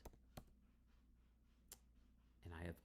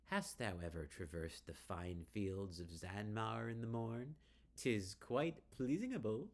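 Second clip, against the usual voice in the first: vaguely English; 3 semitones higher, 3 dB louder than usual. Acted. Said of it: "adopting a vaguely English manner of speech".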